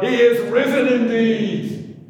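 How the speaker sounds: loud, high roaring reply; crowd's roar. Retold or imitated. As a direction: roar it back like a whole crowd. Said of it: "back came the roar from the crowd".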